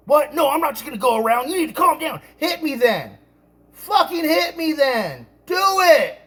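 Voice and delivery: re-enacted dare climbing to a high yell; defiant yelling. Acted quarrel, daring the other man.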